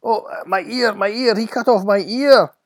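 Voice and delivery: high, loud wail of agony; pained scream. Acted. Wounded guard whose ear was cut off, screaming. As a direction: scream out in pain and shock. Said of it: "screamed the man".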